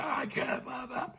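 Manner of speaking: tiny, high, very soft squeak; lost voice. Retold or imitated. Boy telling his mother he can't sing, tiny voiceless squeak. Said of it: "no voice, gone".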